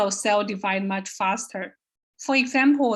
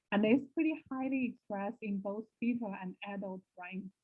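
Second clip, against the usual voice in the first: muffled and much softer, mic covered; muted; 11 dB quieter than usual. Real, not acted. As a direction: talk through a covered microphone. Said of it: "You sound a little muted".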